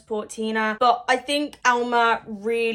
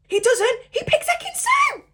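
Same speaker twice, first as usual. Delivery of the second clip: high, loud shocked outburst; shocked shout; 5 dB louder than usual. Real, not acted. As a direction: yell it in disbelief. Shocked reveal of the recoupling.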